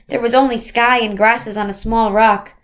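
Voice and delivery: usual speaking voice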